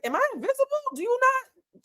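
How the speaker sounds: very high, squeaky-pitched disbelief; incredulous. Retold or imitated. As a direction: retell it as mock bewilderment, pitch shooting up. Retelling her reaction to being overlooked.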